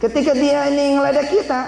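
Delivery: high, loud preacher's call; fiery sermon climax; preaching at full force. Acted. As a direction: build to the answer and shout terima. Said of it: "preaching climax".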